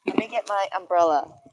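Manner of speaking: ordinary delivery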